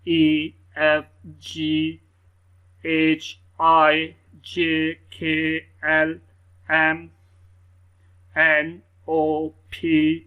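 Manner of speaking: loud, slow letter-by-letter recital; reciting the alphabet. Shown as a demonstration. Teacher reads the alphabet aloud to students, slow and loud. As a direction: call out each letter clearly for the class. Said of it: "मैं एक दफ़ा पढ़ देता हूँ".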